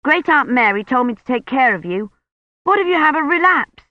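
high, worried insistence; insistent young Colin. A narrator's reading.

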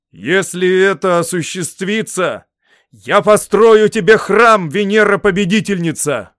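slow, high, full-voiced shout; loud cry. A narrator's reading. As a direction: proclaim a vow to the goddess at full volume. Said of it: "громко крикнул Сулла". (громкий торжественный крик)

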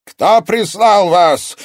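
slow, high, roaring accusation; enraged villain interrogating intruders; thundering sorcerer. A narrator's reading. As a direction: draw out each angry word at full volume. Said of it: "прогремела под сводом".

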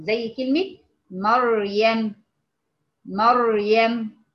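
مريم said slowly with a full, heavy raa; slow tajweed model of مريم; heavy r demo. Shown as a demonstration. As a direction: stretch مريم out, raa thick and rounded. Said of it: "إذن هي مفخمة أيضًا".

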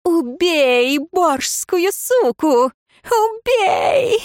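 high, drawn-out shrieking command; frenzied urging. A narrator's reading. Hysterical cries urging murder.

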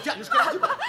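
high, tearful begging; desperate pleading. Acted. Young woman begs her father to stop the fight, tearful plea. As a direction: beg through tears, pitch up high.

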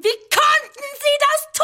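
sehr hoch und laut; hysterischer Aufschrei. Acted. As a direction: die Frage hinauskreischen. Hysterischer Ausbruch. (hoch und laut gekreischt)

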